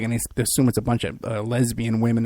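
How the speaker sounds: ordinary talk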